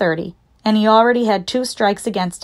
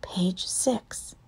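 barely audible whisper; tiny, hushed unvoiced answer; as quiet as possible; 12 dB quieter than usual. A narrator's reading. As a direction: whisper it as quietly as you can. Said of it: "answered as quietly as he could".

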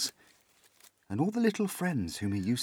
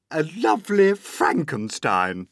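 higher, louder snide aunt; Aunt Spiker sneering; 8 semitones higher, 7 dB louder than usual. Acted. Aunt Spiker mocking her sister, higher and louder. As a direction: set up the insult slyly. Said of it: "Aunt Spiker said".

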